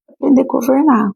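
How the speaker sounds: Aprender pitched high and pressed firmly; emphatic point. Real, not acted. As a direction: lift the phrase high to hammer it home. Preacher drives home the point. (ênfase forte em "Aprender")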